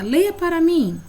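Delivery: mais aguda e mais forte, incentivando; peça com entusiasmo, voz alta; pedido animado de professora. Shown as a demonstration. Professora pede leitura ao aluno. (professora pede ao aluno que leia, aguda e animada)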